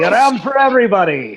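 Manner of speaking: loud announcement. Acted. Player announces a round to the tavern, loudly. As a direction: call it out to the whole room. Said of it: "and announce loudly".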